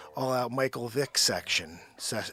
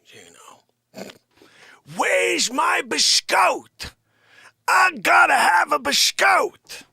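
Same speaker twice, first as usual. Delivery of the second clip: indignant character who keeps bursting into shouts; Dick Facce; 13 semitones higher, 11 dB louder than usual. Acted. Host's alter ego badgering Gino over espresso, blustering character voice. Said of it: "when Dick Facce takes over".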